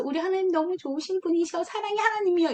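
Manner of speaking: bright, high-pitched gushing about God; child eagerly telling a friend about God; cheerful praise. Retold or imitated. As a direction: sing God's praises eagerly, as a kid would. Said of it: "이랬는데".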